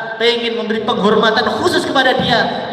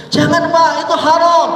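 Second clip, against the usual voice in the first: higher, louder earnest plea; pleading warning; 7 semitones higher, 3 dB louder than usual. Retold or imitated. A friend warning the coffee drinker, higher pleading voice. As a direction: urge him like a worried friend. Voicing a pious person warning.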